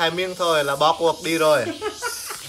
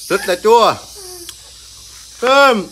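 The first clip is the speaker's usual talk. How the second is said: loud, high cry at the sour taste; sour reaction; 6 semitones higher, 6 dB louder, slower than usual. Real, not acted. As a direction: exclaim at the sourness. (kêu lên vì chua)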